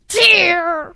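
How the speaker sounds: a playful fake sob on the word; hammed-up teary wail; mock crying. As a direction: pretend to cry the word out. Child voices a tear as crying.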